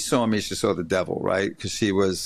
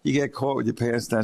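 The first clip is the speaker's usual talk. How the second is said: ordinary talk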